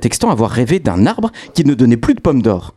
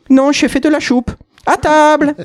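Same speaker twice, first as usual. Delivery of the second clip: put-on old lady voice. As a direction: squeak it out like a fussy old lady. Old lady answers the devil.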